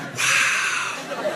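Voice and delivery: breathy, whispered wow; almost admiring under the breath; impressed wow. Retold or imitated. Police officer sees drunk teens chanting, whispered wow. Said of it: "He's like".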